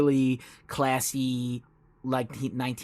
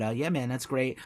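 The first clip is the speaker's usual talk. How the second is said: ordinary talk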